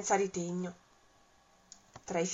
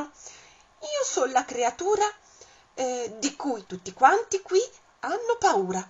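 higher, louder bold mouse; bluffing mouse; 6 semitones higher, 4 dB louder than usual. Acted. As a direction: puff himself up to fool the monster. Il topo bluffa col mostro. (topo spavaldo, voce più acuta e forte)

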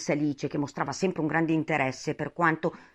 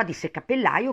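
usual speaking voice

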